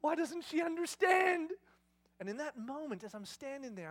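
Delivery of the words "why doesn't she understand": loud high drawn-out wail of frustration; exasperated outburst. Retold or imitated. Dad venting in passageway after tantrum, exasperated yelling. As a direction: rant as if losing your temper. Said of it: "I don't generally lose my temper".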